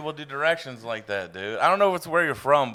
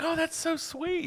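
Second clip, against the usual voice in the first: put-on high, slow swooning girl voice; girly swoon; 6 dB quieter, slower than usual. Mimicking girls cooing over the rooster. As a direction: coo it up high like a smitten girl. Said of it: "They're like".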